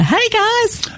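bad American radio host accent, high and bright-eyed; awful American accent. A presenter hamming up an American accent to greet listeners. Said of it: "That was an awful accent".

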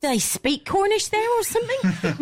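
high-pitched incredulous question; retold clients' query. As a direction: quote baffled clients, voice pitched well up. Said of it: "They went".

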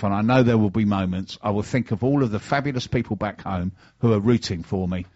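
ordinary talk, nothing marked

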